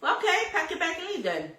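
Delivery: high-pitched, unimpressed parent act; mock parental dare. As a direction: call the kid's bluff. Parent calling child's runaway bluff.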